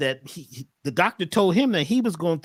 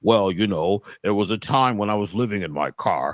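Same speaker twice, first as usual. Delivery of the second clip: another changed voice; 9 semitones lower than usual. Shown as a demonstration. Client retelling the story in a much lower voice. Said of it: "Change your voice again".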